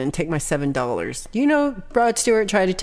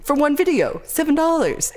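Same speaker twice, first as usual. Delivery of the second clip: high exclaim; 8 semitones higher than usual. Real, not acted. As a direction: pitch jumps way up in disbelief. Exclaiming about seven dollars.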